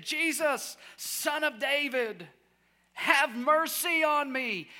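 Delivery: high, loud, slow pleading cry; toned-down scream. Acted. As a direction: call out desperately, holding back from screaming. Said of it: "I'm not screaming like probably he was".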